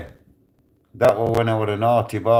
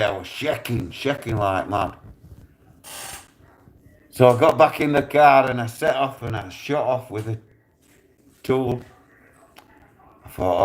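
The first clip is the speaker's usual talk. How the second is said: usual speaking voice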